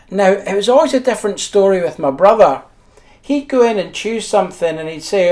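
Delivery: ordinary talk, nothing marked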